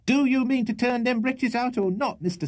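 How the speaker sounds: very high, angry ultimatum; shrill demand. A narrator's reading. Landlady ordering lodger to clear his guests, shrill.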